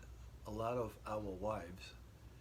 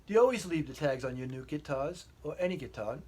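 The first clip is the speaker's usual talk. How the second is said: higher, louder English wife voice; English accent; 6 semitones higher, 9 dB louder than usual. Retold or imitated. Wife questioning the guitar tags in a raised English accent. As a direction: quiz him in a raised English lilt. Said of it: "I'll try to use an English accent".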